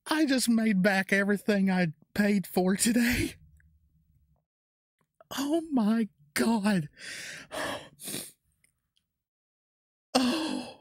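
high-pitched, overwhelmed relief; amazed disbelief. Real, not acted. Collector who made back everything paid, amazed. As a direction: pitch up high, stretching out the final exclamation. Just saw the card's value.

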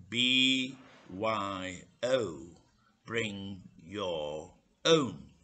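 drawn-out, very slow, word by word; slow spelling out. Acted. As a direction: spell it out slowly for a slow customer. Barman spelling out the rule slowly.

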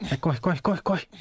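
rising frantic urging; hurry, pitch climbing; being chased. Real, not acted. Fleeing a crowd of enemies.

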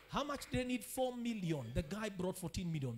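normal talking voice

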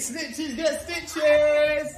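high, slow singsong taunt. Pretend. Dad repeating the taunt at the kid, high and slow. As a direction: sing it up high, teasing.

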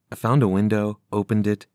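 ordinary delivery, nothing marked

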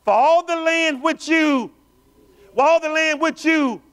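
loud, high, slow preaching; drawn-out preacher's cry; full-force preaching. Acted. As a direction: lift it and hold every word.

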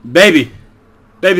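ordinary talk

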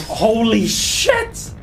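amazed. Real, not acted. Amazed at loot.

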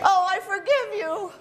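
high, drawn-out mock forgiveness; playfully over-sweet pardon; gushing forgiveness. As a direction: coo it slowly up high. Acted reply to group apology.

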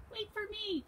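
high, thin cry to be waited for; Mouse. A narrator's reading. Little mouse trails the others in woods, high cry. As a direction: call out in a tiny high voice. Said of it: "cried Mouse".

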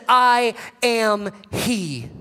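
each word drawn out slowly; slow solemn. Acted. Jesus answering the soldiers, slow and solemn. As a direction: let it land, very slow.

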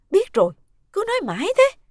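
loud, high-pitched annoyed outburst; irritated cut-off. Acted. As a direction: cut them off, fed up with the nagging. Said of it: "bà sinh bực, liền cắt".

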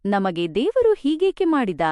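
usual speaking voice

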